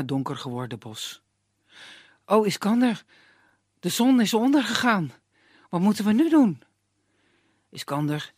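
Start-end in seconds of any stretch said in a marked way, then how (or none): 2.2-6.6 put-on high, loud anxious girl; worried young woman. Girl fretting to Iskander at sunset, anxious. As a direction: fret about the dark.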